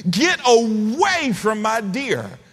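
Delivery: high, drawn-out mock yell; yelling wife. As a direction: holler it slowly like a frantic hunter guarding her kill. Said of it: "yelling at the top of her lungs".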